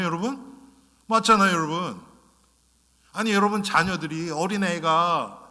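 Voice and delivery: normal talking voice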